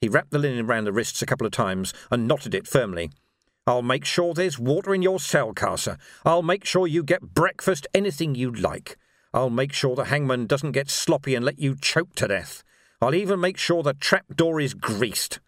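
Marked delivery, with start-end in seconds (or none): 3.7-15.4 raised-pitch, emphatic promises to a prisoner; lawman's grim assurance; grim lawman, menacing. Acted. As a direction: list each promise as a threat.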